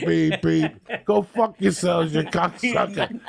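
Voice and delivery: lightly shouted jeer; mock taunting yell. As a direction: taunt them gleefully. Yelling at the Masons.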